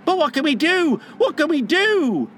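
high, helpless cry; high-pitched boyish alarm; panicked boys. A narrator's reading. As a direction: wail it high like scared children. Frightened boys facing wolves.

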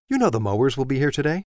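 high, loud and fast, scolding; indignant wife, voice up high; scandalized wife. Acted. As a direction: rattle off the outrage at him.

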